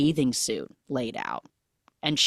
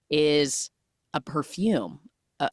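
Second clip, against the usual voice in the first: ordinary talk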